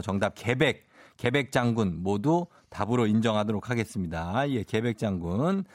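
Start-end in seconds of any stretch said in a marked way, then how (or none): none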